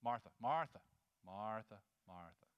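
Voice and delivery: low, patient repeated name; the Lord. Acted. Preacher as Jesus gently answering frustrated Martha, low and unhurried. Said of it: "you have to get the twice".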